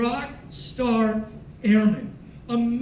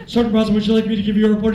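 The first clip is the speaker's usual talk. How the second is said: loud, rapid-fire, low-pitched formal request; imitating an over-keen airman reporting loudly; military reporting bark; 4 semitones lower, 8 dB louder, much faster than usual.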